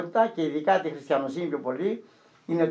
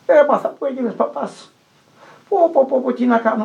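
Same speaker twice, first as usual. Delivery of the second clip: high, loud, weepy lament; imitating a crying old man begging for help; tearful pleading; 8 semitones higher, 4 dB louder than usual. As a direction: wail it like a repentant man in tears. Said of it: "και έκλαιγε ο άνθρωπος".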